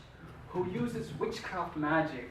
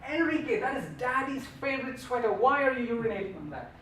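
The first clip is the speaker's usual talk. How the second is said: higher, louder scolding shout; retold yell at the cat; 6 semitones higher, 4 dB louder, faster than usual. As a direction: scold the cat who peed on the sweater. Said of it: "I yelled at him".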